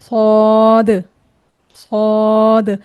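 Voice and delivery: सौद held very long, lips unrounded; full, thick sod. Shown as a demonstration. Teacher models it without rounding lips, long heavy सौद. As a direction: say सौद slowly, heavy, no w glide. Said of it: "इसको पुर पढ़ना है".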